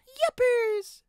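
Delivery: tiny brake van voice chirping; chirped. A narrator's reading. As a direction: squeak it out happily. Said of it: "chirped Delilah".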